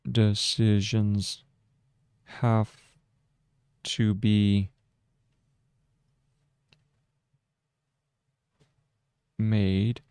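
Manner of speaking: slow, word by word; dictating. Shown as a demonstration. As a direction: dictate each word to the software. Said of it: "so we go".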